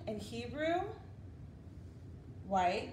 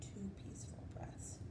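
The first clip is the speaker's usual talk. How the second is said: whispered count; 3 semitones lower, 12 dB quieter than usual. Acted. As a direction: whisper it calmly to the children. Counting peaceful breaths softly.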